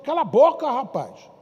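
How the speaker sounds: the crowd yelling at the beggar to shut up; scolding shout. Acted. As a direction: hush him loudly and angrily. Crowd silencing the blind man.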